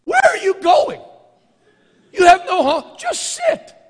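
high, loud exasperated yell at a passerby; hammed-up shout of disbelief; mock shouted scolding. Mock scolding homeless people.

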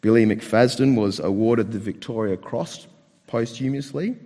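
normal talking voice

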